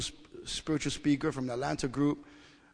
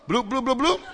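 louder, higher bouncy bloops; imitated bubbling; 6 semitones higher, 5 dB louder than usual. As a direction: pop out a string of bloops. Imitates feelings bubbling up inside.

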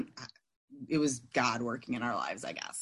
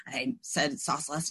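ordinary delivery

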